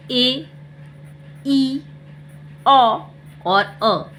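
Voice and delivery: short phonic ए, ई, ओ, अ, each sounded alone; short vowel sounds. Shown as a demonstration. Teacher showing children's phonic sounds, short isolated ए, ई, ओ, अ. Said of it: "A का phonic sound होता है".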